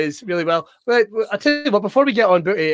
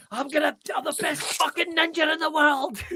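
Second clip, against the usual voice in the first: boastful ninja; 7 semitones higher, 7 dB quieter than usual. Pretend. Acting out ninja movie.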